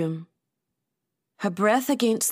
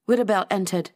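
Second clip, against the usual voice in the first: ordinary delivery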